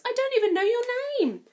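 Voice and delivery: high, loud and fast plea; desperate to stop her; pleading prince. Acted. Prince pleads with Cinderella as she flees, fast and loud.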